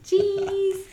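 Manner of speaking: squeaky pubescent voice break, high and loud; hammed-up cracking, like a twelve-year-old boy. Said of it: "My voice is breaking".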